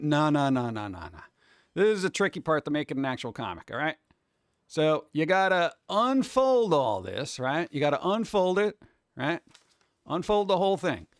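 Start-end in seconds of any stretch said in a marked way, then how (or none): none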